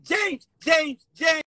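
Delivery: loud, high call of his name; shouted call. Retold or imitated. Calling out to James on the stream, loud and high. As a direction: holler the name out. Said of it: "I said".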